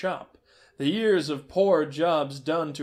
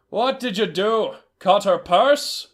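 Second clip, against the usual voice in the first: louder, slow accusation; a stern trader accusing a thief; 6 semitones higher, 8 dB louder, slower than usual. Acted. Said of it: "the trader asked".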